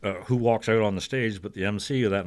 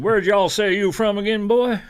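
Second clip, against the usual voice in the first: put-on Southern accent; 13 semitones higher, 8 dB louder than usual. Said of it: "With that accent".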